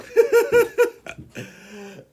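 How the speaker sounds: big high burst of laughter; loud laugh. Real, not acted. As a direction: let out a loud cackling laugh. Laughs at own joke.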